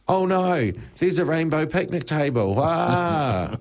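high, hammed-up alarm; mock panic. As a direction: squeal it like a silly panic. Mock panic at rainbow table.

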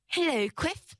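cheerful. Acted. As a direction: greet him gladly. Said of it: "said Pixie cheerfully".

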